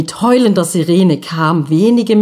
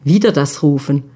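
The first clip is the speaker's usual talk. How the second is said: ordinary delivery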